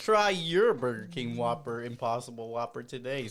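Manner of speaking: ordinary talk, nothing marked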